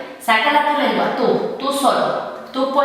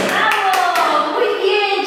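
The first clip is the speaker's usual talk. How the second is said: cheering praise; 6 semitones higher, much slower than usual. Real, not acted. Cheering child's catch.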